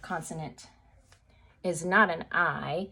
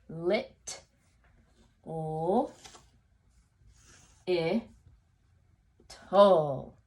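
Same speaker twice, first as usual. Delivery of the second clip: lit, o, I, tol said sound by sound with long pauses; much slower than usual. Shown as a demonstration. Teacher sounds out little part by part for students. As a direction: break little into separate sounds slowly. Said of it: "I'm using my cross-checking strategy".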